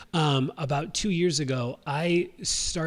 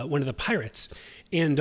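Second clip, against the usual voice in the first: ordinary talk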